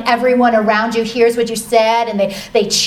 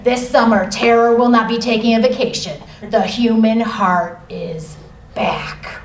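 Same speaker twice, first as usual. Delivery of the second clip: horror trailer voice; 3 semitones lower than usual. Pretend. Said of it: "sounds like it could be the next villain, like in a horror movie".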